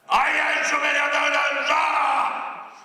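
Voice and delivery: high shouted recital, dropping to a groan; mock poetry declaiming. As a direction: bellow it like an overwrought poet. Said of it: "And the poetry ... was a kind of".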